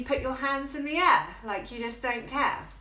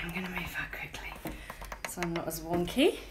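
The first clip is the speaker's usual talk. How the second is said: whisper; 6 semitones lower, 7 dB quieter, faster than usual. Real, not acted. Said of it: "whispered aside".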